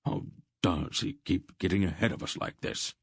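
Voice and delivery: put-on mildly exasperated old companion. A narrator's reading. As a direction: let a touch of exasperation show. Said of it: "Wolfe's voice held exasperation".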